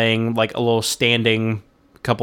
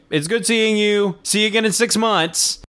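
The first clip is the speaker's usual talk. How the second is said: high-pitched teasing goodbye; retold jokey send-off, lifted in pitch; joking farewell; 8 semitones higher than usual. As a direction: call a playful see-you-later, pitched up. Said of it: "I jokingly, like, waved as we're".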